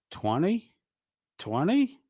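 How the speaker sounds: high rising, scoffing Twenty; mock disbelief. Streamer taunting Chili over the small bounty, rising mock disbelief.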